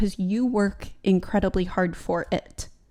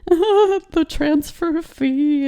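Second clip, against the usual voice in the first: panicked blurt; 7 semitones higher, 3 dB louder, much slower than usual. Retold or imitated. Panicked caller blurting request.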